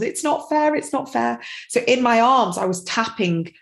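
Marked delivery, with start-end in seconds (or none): 0.0-1.4 high, light mock child's complaint; imitated sobbing child. Toddler upset about a bike in mum's arms, mildly imitated sobbing. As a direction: mimic a small child's upset protest without real sobs. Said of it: "she was sobbing in my arms".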